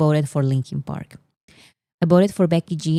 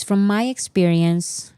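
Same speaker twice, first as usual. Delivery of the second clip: ordinary talk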